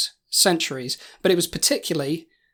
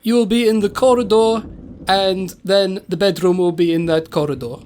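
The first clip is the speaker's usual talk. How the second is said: measured, hammy Transylvanian host voice; Transylvanian accent; 3 dB louder than usual. Acted. Castle host directing guests to their bedroom, Transylvanian accent. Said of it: "that is a Transylvanian accent".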